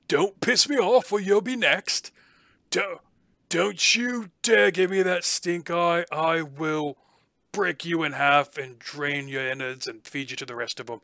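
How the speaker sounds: high, loud snarling threat to the beasts; menacing feeder. Acted. Jailer threatening his caged beasts, high menacing snarl. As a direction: growl threats at the caged creatures. GM voices gruff feeder NPC.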